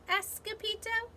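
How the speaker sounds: ordinary delivery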